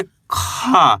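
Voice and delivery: drawn-out, aspirated "खा"; letter खा demo. Shown as a demonstration. Teacher reading out खा for beginners, exaggerated letter demo. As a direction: say "खा" with a strong puff after the k. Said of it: "इसको अपन पढ़ेंगे".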